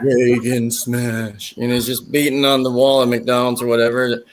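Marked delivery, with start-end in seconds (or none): 0.0-1.5 very slow zombie voice; Ronald Reagan. Retold or imitated. Cartoon zombie president beating on the wall, slow zombie voice. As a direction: stretch the words out like a zombie. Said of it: "he was like a zombie".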